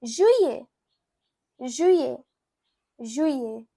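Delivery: juillet as "zhwee-yay"; French pronunciation. Shown as a demonstration. Teacher showing learners July in French, careful French juillet. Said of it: "You can say".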